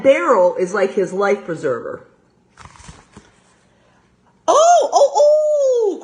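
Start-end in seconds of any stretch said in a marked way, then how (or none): 4.5-6.0 put-on high, loud little boy's cry. Little boy sees the duck, high loud cry. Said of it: "cried the little boy".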